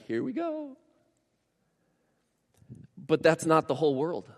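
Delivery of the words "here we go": high, gentle welcoming voice; warm Jesus voice. Retold or imitated. Pastor voicing Jesus embracing his family, high and gentle. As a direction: say it like a hug. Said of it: "be like".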